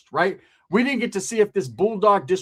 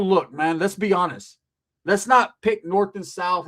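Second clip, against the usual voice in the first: normal talking voice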